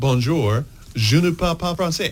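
put-on mild French accent, careful tourist French; a light French accent, carefully polite. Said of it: "I said".

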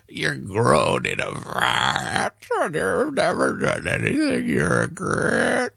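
mocking candidate impression. Host quoting the candidate's false choice, mocking fry. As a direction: parody the politician's strained fry.

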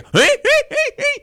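put-on high, squeaky old-man cackle; grandpa cackle. Grandpa laughing at his own joke, high squeaky cackle. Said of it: "laugh in that very".

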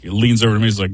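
ordinary delivery

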